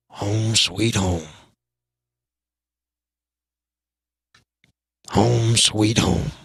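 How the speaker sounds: low, slow, wistful murmur. Retold or imitated. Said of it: "that was [name] going".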